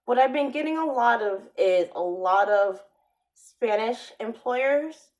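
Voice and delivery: usual speaking voice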